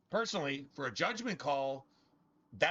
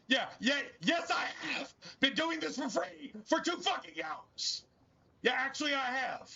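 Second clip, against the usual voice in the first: exasperated yelling; 9 semitones higher than usual. Real, not acted. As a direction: shout it back, fed up and incredulous. Exasperated rant at chat troll.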